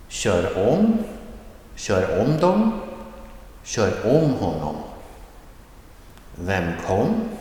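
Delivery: rising tone on the second syllable, as in "Ta med"; careful phrase drill, pitch climbing on the second syllable as in "Kör om"; rising tone demo. Shown as a demonstration. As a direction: lift "med", "till", "om" and "kom" with a rising pitch. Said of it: "with the rising tone in the second syllable".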